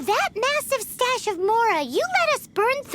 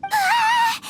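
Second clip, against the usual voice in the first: sudden high yelp of fright, loud; comic scream of alarm; panicked shriek; 9 semitones higher, 4 dB louder than usual. Pretend. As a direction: shriek like the threat is real. Paimon shrieks at leg joke.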